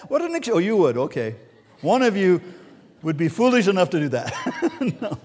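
ordinary talk

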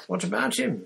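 high, hard-edged retort. A narrator's reading. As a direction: throw the question back coldly. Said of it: "said Parvati in a hard voice".

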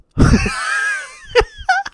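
shrieking high laugh; loud laugh. Real, not acted. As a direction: burst out laughing. Laughing at Horges joke.

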